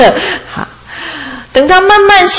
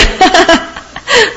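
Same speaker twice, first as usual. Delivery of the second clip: high giggling sheep laugh; a teasing high giggle; 9 semitones higher than usual. Acted. Sheep giggles at the master, high and teasing.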